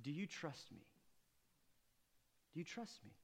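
hushed plea of trust; quiet question. Acted. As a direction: ask it gently, almost to yourself. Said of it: "Another translation".

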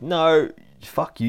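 high, loud, slow refusal; defiant retort. Acted. Acted defiant reply to monster.

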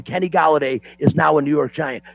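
ordinary talk

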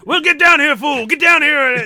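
mock shouting match in a celebrity voice; Mister T. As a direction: holler back as a blustering tough guy. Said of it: "they all be yelling back and forth".

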